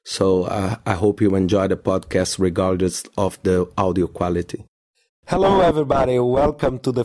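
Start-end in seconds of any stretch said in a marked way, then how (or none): 0.1-4.7 low, worn voice from a cold; voice hoarse from a cold. Real, not acted. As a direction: speak through a cold. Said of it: "I got a cold".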